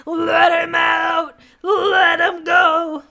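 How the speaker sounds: loud, drawn-out chant; mock protest shout. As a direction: shout it slowly, like a rallying cry. Calling for cat's release.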